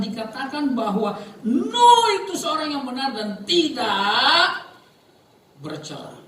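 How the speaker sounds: loud, high, stretched proclamation; ringing, slow pulpit declaration; emphatic preaching. Real, not acted. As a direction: proclaim the verse, drawing words out. Preacher builds up scripture quotation emphatically.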